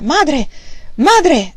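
loud urgent call up the stairs; Madre! gridato forte; shouting for mother. A narrator's reading. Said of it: "gridò".

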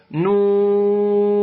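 نون with the waw held very long and slow; نون chanted out, the middle vowel stretched slowly; six-count madd. Shown as a demonstration. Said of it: "تمدها بمقدار ست حركات".

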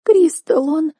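high, tearful wail; snotty crying. Acted. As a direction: sob it, lip trembling. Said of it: "заревела сестра".

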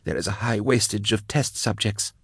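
reluctant captive, quiet and high; unwilling confession. A narrator's reading. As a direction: let it out against your will. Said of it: "fighting not to say these things".

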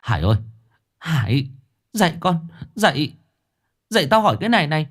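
high, rushed shaking awake; mother shaking her son awake, frantic; urgent. A narrator's reading. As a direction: wake him right now. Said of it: "giọng đầy gấp gáp".

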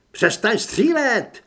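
high, loud, slow command; drawn-out shouted demand; woman's shout. A narrator's reading. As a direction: shout it as an order across gunfire. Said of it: "Byl to ženský hlas".

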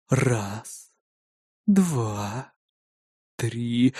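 low, soft, spaced-out count; counting to calm down. A narrator's reading. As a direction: count slowly under your breath to keep control. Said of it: "попытался досчитать до десяти, чтобы успокоиться".

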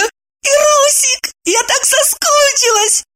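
high joyful squeal. Acted. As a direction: squeal it with delight. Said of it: "радостно взвизгнула Евдокия".

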